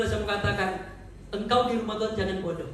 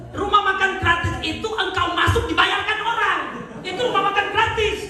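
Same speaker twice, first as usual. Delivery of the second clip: high, loud, driving sermon voice; full-force preaching; 10 semitones higher, 7 dB louder than usual. Acted.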